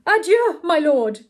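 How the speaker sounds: high, loud, showy goodbye; cried farewell. A narrator's reading. Pupil answering his lordship's French goodbye, loudly cried. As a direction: call it out, determined not to be outdone. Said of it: "cried Mister Saltina, not to be outdone".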